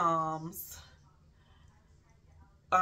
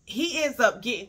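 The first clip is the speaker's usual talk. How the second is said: ordinary talk, nothing marked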